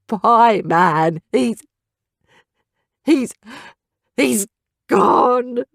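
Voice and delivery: halting, broken-up words; trembling grief. A narrator's reading. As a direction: stammer through the loss. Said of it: "Scout's beak was trembling".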